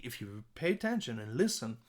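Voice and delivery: normal talking voice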